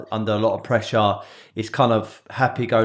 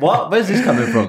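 high, loud mock disbelief; retold bafflement; 7 semitones higher, 5 dB louder than usual. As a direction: replay being baffled, voice jumping up and louder. Retelling his bafflement at the accent.

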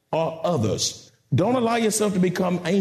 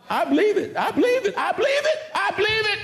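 each repeat more fervent and higher pitched; fervent declaration; 13 semitones higher, faster than usual. Real, not acted. Preacher affirming God's word, building fervour. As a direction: repeat it climbing to a very high cry.

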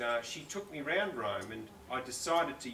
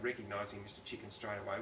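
ordinary talk

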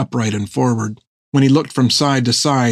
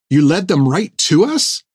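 higher, mildly angry accusing question; raised in anger; 5 semitones higher than usual. A narrator's reading. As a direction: accuse them, pitch climbing at the end. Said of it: "her voice raised in anger".

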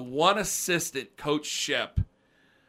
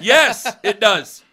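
emphatic; 5 semitones higher, 10 dB louder, much slower than usual. Real, not acted. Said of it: "was all this to say that motivation does work".

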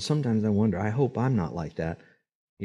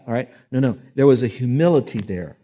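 usual speaking voice

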